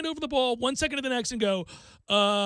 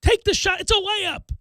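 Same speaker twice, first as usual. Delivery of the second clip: loud, high fan yell at the screen; frustrated fan barking at the TV; 10 semitones higher, 7 dB louder than usual. Retold or imitated. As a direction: shout at Ben through the television. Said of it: "I'm yelling that".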